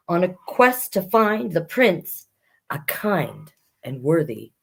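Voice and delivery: lively rhyming read-aloud; reading a rhyming fairy tale to children; storybook narration. Acted. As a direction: tell the fairy tale to young listeners. Reading Prince and Knight aloud.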